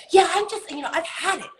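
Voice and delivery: child voicing that they've had enough, mock fed-up; exasperated child. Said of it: "snorting and just angry".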